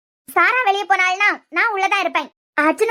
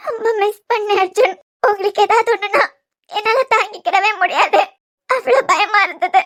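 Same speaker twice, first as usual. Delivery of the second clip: tearful, sobbing with relief; crying. Acted. Wife to injured husband who has just woken, crying. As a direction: pour out the fear through tears. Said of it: "என்னமா அழுற?".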